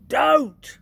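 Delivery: very high, very loud cry. Acted. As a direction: shout it in pain. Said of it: "cried the B F G".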